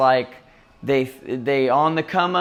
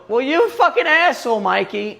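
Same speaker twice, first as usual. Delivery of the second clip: high, louder mocking voice of an offended stranger; mock insulted reply; 11 semitones higher, 4 dB louder than usual. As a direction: whine it like a wounded stranger. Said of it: "And then they go".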